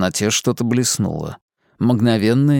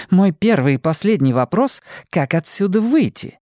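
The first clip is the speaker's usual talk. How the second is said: higher, biting sarcastic question; 7 semitones higher than usual. Acted. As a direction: needle him with sarcasm. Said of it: "язвительно сказала она".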